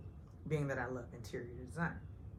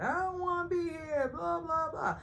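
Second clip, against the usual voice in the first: much higher, hammed-up whine; mock whiny Sonya; 12 semitones higher than usual. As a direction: imitate a sulky complaint. Depressed Sonya mocked.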